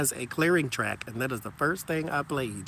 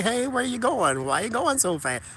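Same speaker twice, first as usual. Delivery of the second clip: mock high-pitched question; 10 semitones higher, 3 dB louder than usual. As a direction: play the nosy friend calling after her. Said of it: "Don't say".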